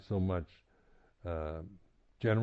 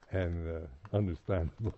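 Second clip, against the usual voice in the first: ordinary talk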